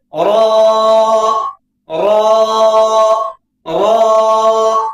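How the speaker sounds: رو with rounded lips, thick; rounded heavy ro. Shown as a demonstration. Teacher models a rounded, heavy رو. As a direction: round the mouth for رو. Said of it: "اس طریقے سے".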